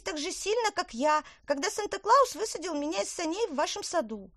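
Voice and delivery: high, bright little elf; squeaky elf speaking. Acted. Elf voice in children's story.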